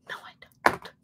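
hushed, breathy mock denial; whispered denial. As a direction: whisper it as a playful protest. Acts out denying her accent.

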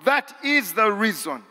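high, loud rising sermon climax; full-force preaching. Acted. Preacher exhorting the congregation that they are chosen, high and loud. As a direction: drive it home with fervor.

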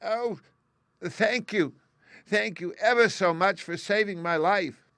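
high, loud little mouse squeak; squeaky little voice. A narrator's reading. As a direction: gush thanks in a tiny squeaky mouse voice. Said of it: "it said in a squeaky little voice".

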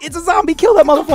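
high, loud alarm at a zombie; exaggerated high-pitched yell; panicked mock shout. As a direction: shout it out as a comic imagined reaction. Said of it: "She was like".

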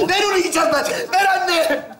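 high, desperate yelling; frantic pleading shout. Acted. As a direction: beg wildly, as if starving. Son fights to eat the remote.

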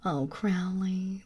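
ordinary talk

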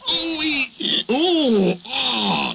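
put-on high, strained groans of old trucks; trucks groaning. A narrator's reading. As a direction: groan like rusty trucks being dragged. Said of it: "the trucks groaned".

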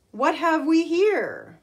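high, slow fluttery lady; fluttery emu. Acted. Emu peering over the brew, fluttering high. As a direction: flutter it like a graceful bird. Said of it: "she fluttered".

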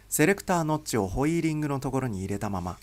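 ordinary delivery, nothing marked

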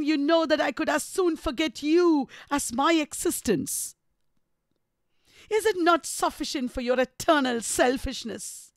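theatrical, tormented accusation; savage, anguished Heathcliff. A narrator's reading. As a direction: reproach her with mounting hurt, stressing key words. Reads Heathcliff's anguished speech.

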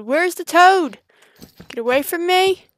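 high, loud and frantic. Real, not acted. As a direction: panicky call after the toad. Frantic search.

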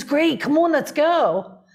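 high, bright mock of an eager go-ahead; cheerful urging. Woman imitating David eagerly inviting her along, high and upbeat. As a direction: wave her along, all enthusiasm.

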